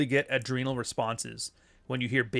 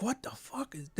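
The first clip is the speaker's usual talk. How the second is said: high-pitched shocked reaction; stunned disbelief; 9 semitones higher, 9 dB quieter than usual. Retold or imitated. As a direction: act stunned, voice jumps up. Imita la cara del amigo.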